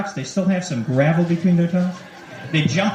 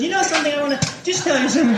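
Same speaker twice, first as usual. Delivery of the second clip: put-on girlfriend's voice; 9 semitones higher, much faster than usual. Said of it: "she goes".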